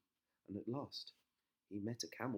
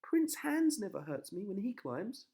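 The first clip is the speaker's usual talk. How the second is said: put-on high, loud girlish complaint; a princess whining at the witch, high and loud; high, loud protest; Rapunzel complaining; 8 dB louder than usual. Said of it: "cried Rapunzel".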